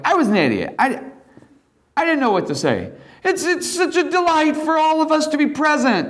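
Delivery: high, laughing, embarrassed Peter; sheepish Peter. Acted. Peter admitting to the congregation he was an idiot, laughing. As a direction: laugh at your own foolishness as Peter.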